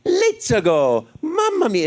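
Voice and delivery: cranked-up, high-energy game hero voice; Mario. Shown as a demonstration. Actor showing how his voice changed, cranked-up Mario. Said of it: "everything was much more cranked up with energy".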